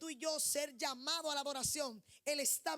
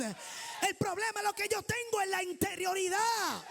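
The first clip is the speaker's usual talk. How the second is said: loud, higher shouted preaching; full-force preaching; 5 semitones higher, 5 dB louder than usual. Acted. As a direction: proclaim it over the congregation.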